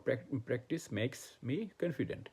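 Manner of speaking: very slow, word by word English sentence; slow model sentence. Shown as a demonstration. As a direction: dictate it slowly to learners. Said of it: "spelling". (খুব ধীরে উদাহরণ বাক্য পড়া)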